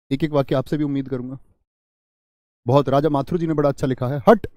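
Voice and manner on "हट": चेतावनी. Shown as a demonstration. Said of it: "चेतावनी दे दी".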